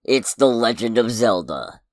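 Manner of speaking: big title reveal, trailer style; trailer announcer voice. Pretend. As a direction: land the title like a movie trailer. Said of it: "I'm gonna try and do my best trailer announcer voice".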